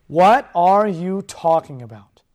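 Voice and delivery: What hit hard and stretched. Shown as a demonstration. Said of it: "the title of my message is simply this".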